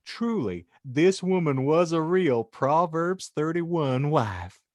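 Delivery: ordinary talk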